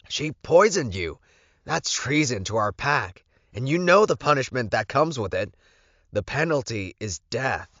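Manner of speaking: a hint of a loyal beta smelling treason; indignant beta. Lorenzo to his Alpha about the poisoning, mildly outraged.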